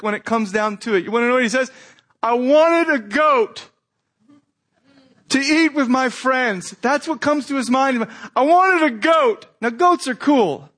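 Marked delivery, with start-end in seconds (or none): 2.2-6.7 slow, higher whining complaint; mock the sulking son; lightly hammed-up petulant son; whiny older son. 8.3-9.5 higher, louder mock whine of the son; hammed-up petulant whine. Repeats son's complaint mockingly.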